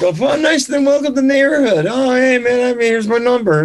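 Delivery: gushing hello over the fence, lightly mocked; mock friendly neighbor. Retelling the neighbor's welcome in a mock sugary voice.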